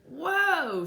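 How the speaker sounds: loud, high burst of awe; big astonished exclamation; amazed whoa. A narrator's reading. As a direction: exclaim at an amazing catch. Amazed at Dad's big catch.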